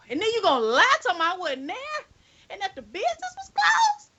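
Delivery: mock outrage, milking the disbelief; incredulous rant. To the lying driver, an outraged, incredulous high-pitched rant.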